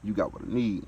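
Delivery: put-on loud, smooth pickup line to a girl. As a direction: lay it on loud and smooth like a player. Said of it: "that smooth look to her".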